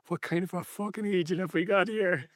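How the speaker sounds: high, exasperated mock grumble to himself; irritated presenter. Bill alone in the taxi, lightly voiced exasperation. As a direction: grumble it when the cabbie's gone. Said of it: "he goes".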